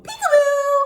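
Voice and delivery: high, loud peekaboo call. Acted. Teacher plays peekaboo with children. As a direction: pop out with it.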